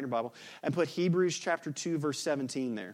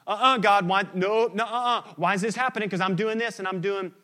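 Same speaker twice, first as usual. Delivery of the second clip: higher and louder, indignant complaint; whining protest; 6 semitones higher, 8 dB louder than usual. Retold or imitated. Believer keeping score complains to God, indignant whine. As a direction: protest to God like you were cheated.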